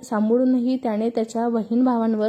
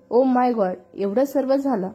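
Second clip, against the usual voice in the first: usual speaking voice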